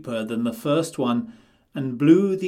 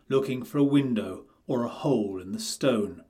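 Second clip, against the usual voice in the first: ordinary delivery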